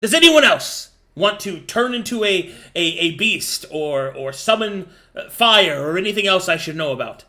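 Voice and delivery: loud, high outburst of authority; exasperated Inquisitor. Acted. Inquisitor silencing the group about beasts and spells, exasperated shout. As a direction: burst out shouting, then rant on loudly. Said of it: "The Inquisitor will go".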